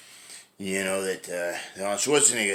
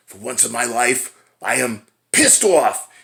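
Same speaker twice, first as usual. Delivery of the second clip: mock angry Julius; 2 semitones higher, 9 dB louder, slower than usual. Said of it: "then he just goes off".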